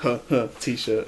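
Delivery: soft, slow, half-hearted imitation of the heckler; muted mock chuckle. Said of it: "not even just like".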